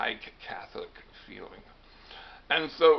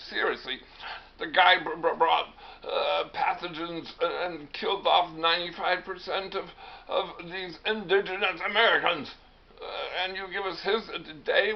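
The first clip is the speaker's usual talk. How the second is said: raised-pitch, stammering protest; acted indignation; 6 semitones higher, 4 dB louder than usual. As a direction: protest in mock outrage, voice pushed up. Hiawatha protests Columbus Day in skit.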